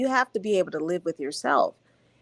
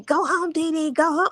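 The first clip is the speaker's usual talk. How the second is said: high-pitched mock scream at normal volume; retold scream; 9 semitones higher than usual. Panelist retelling her yelling at the TV, mock scream. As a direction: imitate screaming, pitched up but held back. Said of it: "when she was screaming".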